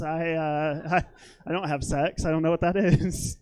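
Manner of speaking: ordinary talk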